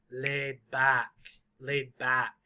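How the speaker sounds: laid back, weight landing on BACK; low pitch, lean on the word BACK; stress on BACK. Shown as a demonstration. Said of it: "The very loud word is back".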